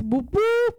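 high, loud celebratory whoop; excited cheer. Real, not acted. Cheering the podcast anniversary.

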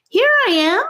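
high, eager yell. A narrator's reading. Boy calls to his brother through the woods, high yell. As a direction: shout so the brother can find you. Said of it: "yelled Charlie".

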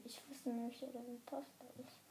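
ordinary delivery

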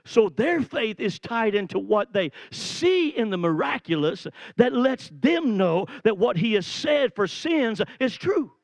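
ordinary delivery

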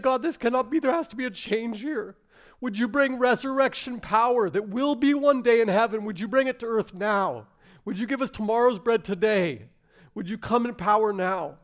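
high, loud, insistent plea to God; crying out in prayer. Retold or imitated. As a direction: retell the congregation's fervent intercession at full force. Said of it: "you cried out".

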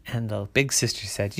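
ordinary delivery, nothing marked

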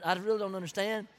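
ordinary delivery

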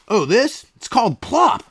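high, beaming salesman voice in a skit; pushy pitchman. Acted. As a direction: sell it like a cheesy TV ad guy. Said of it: "I got a commercial coming up".

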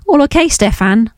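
lightly voiced West Country accent, asked high; a gentle West Country granny greeting her son; West Country old lady. A narrator's reading. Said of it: "in her West Country accent".

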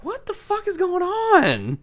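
high, slow drawn-out disbelief; hammed-up high bewildered question; mock baffled. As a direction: play stunned confusion. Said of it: "And I'm like".

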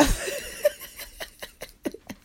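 high, soft, wheezy laugh; long laugh trailing away; disbelieving laugh. Real, not acted. At a dude's presumption, laughing in disbelief.

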